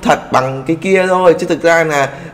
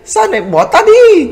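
much higher, mock-frightened wail; scared G-Man voice; 13 semitones higher than usual. Narrator voicing G-Man as he begs not to be abandoned, high mock-frightened wail. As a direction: squeal it like a panicking villain left alone. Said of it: "kiểu sợ hãi các bạn ơi".

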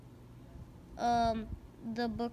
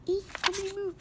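high little eek; mock frightened squeak; 8 semitones higher than usual. Crook squeaking at the gang to hurry. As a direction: squeak it like a scared cartoon.

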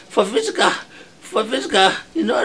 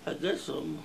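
weak pained voice; 9 semitones lower, 18 dB quieter, slower than usual. Acted. Injured man groaning his complaint.

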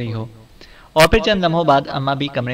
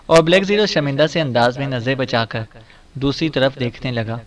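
usual speaking voice

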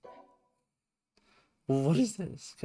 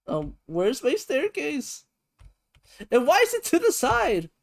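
very high, louder, frustrated whine; high exasperated whine; 13 semitones higher, 4 dB louder than usual. Real, not acted. Frustrated with building.

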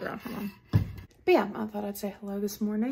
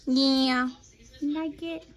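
doting dog-mom voice, higher and louder; 5 semitones higher, 6 dB louder than usual. Real, not acted. Mother baby-talking to the dog.

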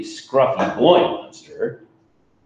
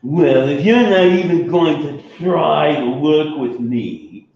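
mild whine; 5 semitones higher, 4 dB louder than usual. Acted. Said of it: "Mac whined".

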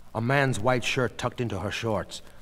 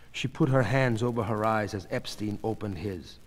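usual speaking voice

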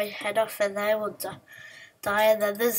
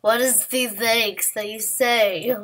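loud demanding; 4 dB louder, slower than usual. Pretend.

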